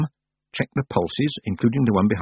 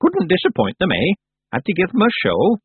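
put-on high, loud and proud old woman; old witch proudly pleased with her trick; 10 semitones higher, 4 dB louder than usual. A narrator's reading. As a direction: boast like a mischievous crone. Miss Treason gleeful.

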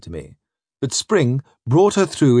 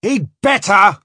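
raised, threatening growl; 8 semitones higher, 4 dB louder than usual. Acted. Spring grudgingly accepting the news, growling. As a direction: growl it as a captain's warning. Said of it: "growls Spring".

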